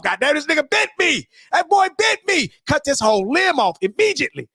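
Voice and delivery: high, hammed-up shouting; comic frantic outburst, high-pitched; mock panic. Mock panic over being bitten.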